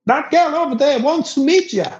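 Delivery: high-pitched mock call; high, insistent imitation; voiced local guy. Imitates local guy calling him.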